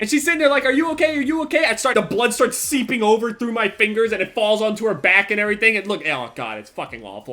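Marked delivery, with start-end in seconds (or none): 0.6-1.6 higher, concerned imitation of her; mock her alarmed check-in; worried girlfriend. Girlfriend checking on him after the headbutt, higher and worried.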